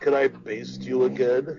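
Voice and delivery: slow, soft, creepy lover voice; creepy seductive whisper. Pretend. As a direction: drawl it like a greasy seducer.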